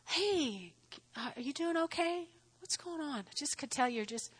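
soft, quiet, caring check-in; gentle soft concern. Shown as a demonstration. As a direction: lean in and ask softly, like to someone crying. Soft caring approach to crying lady.

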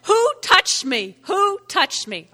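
high, loud, drawn-out demand; demanding call. Acted. Jesus turns round to ask the crowd, demanding call. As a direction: call out the question over a crowd.

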